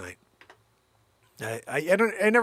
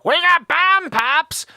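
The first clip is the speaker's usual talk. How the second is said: higher, louder, slow sing-song vendor call; ice cream truck call; 11 semitones higher, 9 dB louder, slower than usual. Acted. Comic calling from a passing ice cream truck, a slow, loud vendor call. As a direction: stretch it out like the truck's loudspeaker.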